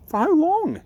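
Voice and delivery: put-on a small girl pressing her mum for an answer; eager little girl. Ella asks her mum.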